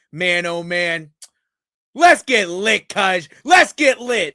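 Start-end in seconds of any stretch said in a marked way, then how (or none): 1.9-4.3 loud, high hype shout; rowdy party animal. Acted. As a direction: get the party going. Hype opening shout to chat.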